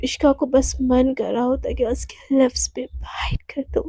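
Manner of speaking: soft whisper telling the secret urge; hushed whisper; whispered narration. A narrator's reading. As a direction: breathe it like a confession. Narrating desire.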